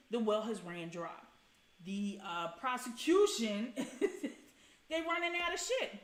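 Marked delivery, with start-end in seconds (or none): 2.6-5.9 high, slow words broken by a laugh. Real, not acted. Streamer laughing at the prosecution, laughing while speaking.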